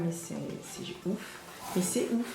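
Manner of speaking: soft, stunned murmur; real quiet awe; hushed amazement. Real, not acted. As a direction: say it low, almost to yourself. Stunned by the hair products.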